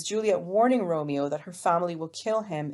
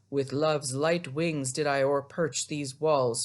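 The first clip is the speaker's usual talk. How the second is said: slow, lowered dreamy lover; Romeo; 3 semitones lower, slower than usual. Acted. Teacher playing Romeo the dreamer to Juliet, slow low voice. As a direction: draw it out like a lovestruck dreamer. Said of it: "being ever the dreamer".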